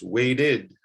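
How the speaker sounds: "waited" stretched into WAY-DID; "waited" with a separate "id" ending; two distinct syllables. Shown as a demonstration. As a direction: say "waited" slowly in two beats. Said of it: "that's a two-syllable word".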